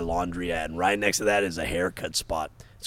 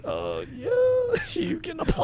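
imitated lady's unsure, halting reply; hesitant mock customer-service answer; hesitant shop lady. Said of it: "the lady was like".

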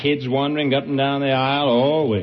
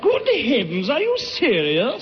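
put-on English accent; 3 semitones higher than usual. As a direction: stammer through it as a comic Briton. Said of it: "that's a wonderful English accent".

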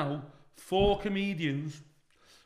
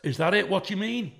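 louder, challenging retort; defiant comeback; 4 semitones higher, 7 dB louder than usual. Retold or imitated. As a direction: square up to him, demanding an answer. Confronting the heckler in car park.

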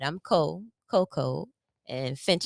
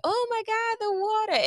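amazed gasp; faster than usual. Retold or imitated.

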